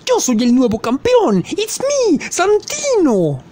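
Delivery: campeón de acento marcado que salta a un agudo extremo; Santino. Acted. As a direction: sube muchísimo y estira el nombre al final. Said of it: "acento tan marcado". (presentación aguda con acento exagerado)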